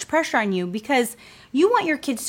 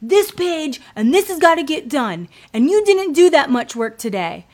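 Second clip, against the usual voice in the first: higher, louder mock of a pushy teacher; pushy mom; 5 semitones higher, 4 dB louder than usual. A mom forcing Brooklyn through work, bossy and higher. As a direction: push her to finish the page. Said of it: "I would force Brooklyn and be like".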